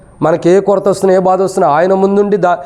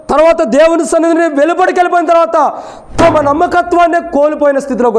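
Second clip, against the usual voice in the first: high-pitched, insistent sermon delivery; preaching; 9 semitones higher than usual. Real, not acted. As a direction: preach it in a raised, sustained voice.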